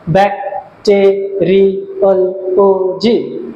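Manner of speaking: "Bacteriology" slowly in six separate beats; syllable by syllable. Shown as a demonstration. Teacher recapping six syllables, "bacteriology" slowly divided. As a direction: give each syllable of "bacteriology" its own beat. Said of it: "ছটা syllabus".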